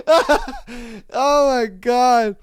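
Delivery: slow, high, helpless laughing; can barely get the words out for laughing. Real, not acted. Cracking up over the toaster-unplugging post, slow helpless laughter.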